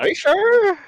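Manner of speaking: high sly teasing question. Real, not acted. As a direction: needle him playfully. Teasing friend.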